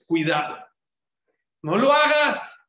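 high, loud warning; urgent warning. Real, not acted. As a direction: warn them hard not to do it. Preacher warns the congregation. (advertencia fuerte y aguda)

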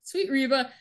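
ordinary talk

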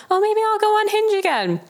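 high sing-song musing; breezy self-talk. Retold or imitated. Voicing her own past whim.

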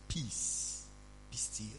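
hushed whisper of the command; a pastor whispering calm over a storm; whispered peace. Pretend. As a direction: breathe the words out as a whisper. Calming the storm, telling them to say it.

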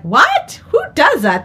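high, loud mock disbelief; pretend incredulity. Someone reacting to being catfished, incredulous. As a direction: blurt it out as if just catfished.

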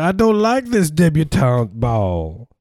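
high, slow Southern drawl drifting into German; Southern accent, slightly German. Acted. Guest grumbling about the debutante ball, slightly German Southern accent. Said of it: "my Southern accent is, is slightly German".

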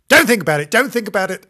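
loud, high mock horror; hammed-up horrified plea. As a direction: recoil in mock disgust. Mock horrified aside to kids.

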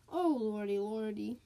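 ordinary delivery

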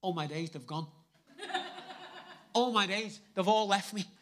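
laughing through the words, delighted; laughing at the mirror as the chicken pox is gone; laughing joy. Retold or imitated. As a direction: giggle at the mirror in relief. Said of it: "I'd be like this in the mirror going".

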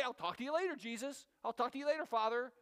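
light, friendly goodbye to God, rising at the end; casual farewell. Shown as a demonstration. Pastor models ending prayer casually, friendly and light. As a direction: say bye like to a close friend. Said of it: "Just say".

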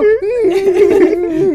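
laughing hard, pitch jumping up; high burst of laughter. Real, not acted. Friend cracks up before telling the story, high laughing burst.

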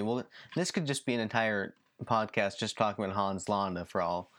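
ordinary talk, nothing marked

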